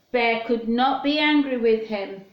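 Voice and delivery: ordinary delivery, nothing marked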